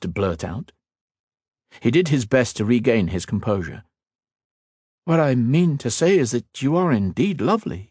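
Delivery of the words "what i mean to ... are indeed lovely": a hint of a calm young lord; level and sincere, raised a little; earnest mage, lightly voiced. Ethan explaining to the Queen, lightly voiced earnest.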